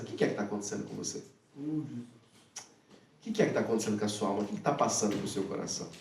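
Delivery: Jesus gently asking the grieving disciple, softly; calm and gentle. Retold or imitated. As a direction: ask quietly, as if drawing someone out. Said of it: "E Jesus, bem calmamente".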